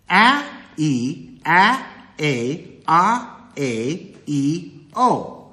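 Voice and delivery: each vowel of the sentence punched separately; vowel sounds isolated. Shown as a demonstration. Teacher counting eight syllables, each vowel sounded alone. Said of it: "eight syllables".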